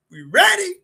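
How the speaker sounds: giddy rising shout to the crowd; high hype call. Real, not acted. Hyping up the show start.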